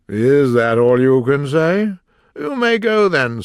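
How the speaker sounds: a slow, loud, scornful king; impatient king voice. Acted. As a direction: dismiss him impatiently. The King dismissing.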